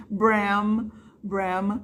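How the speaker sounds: Bram whispered, then lips closed on the M; closed mouth on M. Shown as a demonstration. Teacher showing students how to close the mouth on Bram. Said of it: "fecha a boca pra falar Bram".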